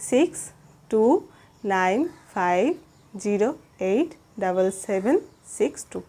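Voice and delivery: each digit of the phone number said slowly, with pauses between; slow, spaced digits. Shown as a demonstration. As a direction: read the number out slowly so it can be written down. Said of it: "আমি আস্তে আস্তে বলে দিলাম". (ধীরে ধীরে থেমে থেমে number বলা)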